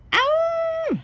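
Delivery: long, very high howl; sustained "aooo" cry; dinosaur howl. A narrator's reading. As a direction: let out a playful high roar. Angry little dinosaur howls.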